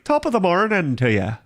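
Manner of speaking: a playful stage-Irish greeting; sing-song Irish accent. Shown as a demonstration. Streamer jokes to chat in a mock Irish accent. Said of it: "sing-songy like this".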